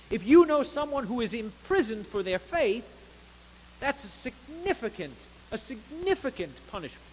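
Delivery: full-throated sermon delivery; fervent preaching. Acted. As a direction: preach it out to the whole church.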